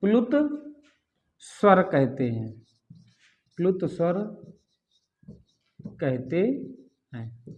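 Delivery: slow, low, soft dictation; word by word with long pauses, quietly. Shown as a demonstration. Teacher dictating the pluta vowel definition, slow dictation.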